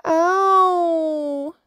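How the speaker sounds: long, high, loud "ow"; howl of pain. A narrator's reading. Young dolphin jabbed in the belly, howling. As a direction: wail it out, pitch way up. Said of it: "howled Hector".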